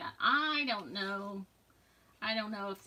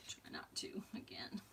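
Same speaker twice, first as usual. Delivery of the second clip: much softer whispered reminder; whispered note to self; 10 dB quieter than usual. Real, not acted. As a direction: whisper a warning to yourself. After getting glaze on fingers.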